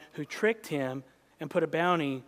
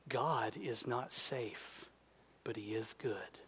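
solemn hush; 7 semitones lower, 12 dB quieter, slower than usual. Acted. Solemn reading of fortune cookie.

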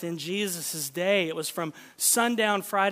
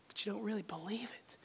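whisper; 13 dB quieter than usual. Real, not acted. Sermon point whispered.

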